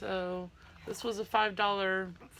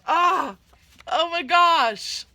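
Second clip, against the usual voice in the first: a loud, high burst of delight; excited shriek; 7 semitones higher, 10 dB louder, slower than usual. Real, not acted. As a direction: shriek it with real joy. Opening surprise gifts in mail.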